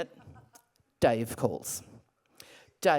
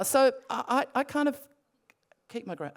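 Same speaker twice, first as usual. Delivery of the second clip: normal talking voice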